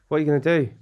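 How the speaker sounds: ordinary delivery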